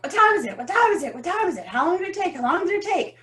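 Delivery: high, quick, eager asking; eager demand. Retold or imitated. At the top she pesters Tyler for her time, high and eager. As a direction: pepper him with questions, high and urgent.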